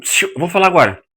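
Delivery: loud, high, cutting someone off; aggressive interruption. Shown as a demonstration. Teacher acting an aggressive talker cutting in, loud. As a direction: shush them and grab the floor. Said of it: "Isso é uma comunicação agressiva".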